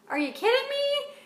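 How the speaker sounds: shocked at a flea leaping off the cat; disbelieving outburst. Retold or imitated. Coach reacting to a flea, high disbelief. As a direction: pitch shoots way up, stunned.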